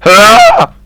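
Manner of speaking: sudden high cracked yelp; startled cry that cracks very high. Real, not acted. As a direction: let the voice break up high on the shout. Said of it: "What was that voice crack?".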